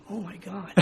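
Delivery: very soft inner aside; silent freak-out. Retold or imitated. Recruit standing there not saying a word, hushed disbelief. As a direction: say it under your breath, to yourself. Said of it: "I'm freaking out going".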